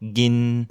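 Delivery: "Gin" level, held mid tone. Shown as a demonstration. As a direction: keep "Gin" flat and level. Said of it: "on a un ton moyen".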